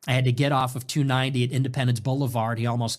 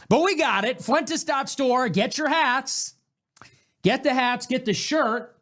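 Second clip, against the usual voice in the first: hyped sales pitch; 9 semitones higher than usual. Real, not acted. Plugging his merch store.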